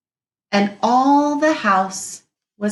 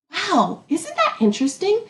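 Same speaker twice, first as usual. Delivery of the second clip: much higher, quick wow; excited; 8 semitones higher than usual. Shown as a demonstration. Teacher to class, amazed and bright. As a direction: sound amazed for the kids.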